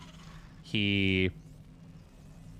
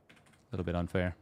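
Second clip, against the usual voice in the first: softer, lower muttered echo; quiet muttered repeat; 3 semitones lower, 7 dB quieter than usual. Shown as a demonstration. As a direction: mutter it again under your breath. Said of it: "it's a little bit unfair".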